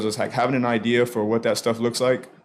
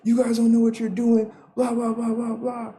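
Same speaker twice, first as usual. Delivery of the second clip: high, mocking drunk-bystander yell; hollered protest pitched way up; retold screaming; 13 semitones higher than usual. Club-goer protesting at the cops, high mock scream. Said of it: "screaming at the cops".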